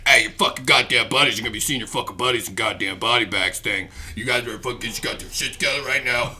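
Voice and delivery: very low drill-instructor voice; Staff Sergeant DeBell. Retold or imitated. Drill instructor warning recruits about war, in a low imitated growl. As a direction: imitate a drill instructor, deep and rapid toward the end. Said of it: "drill instructor named Staff Sergeant DeBell".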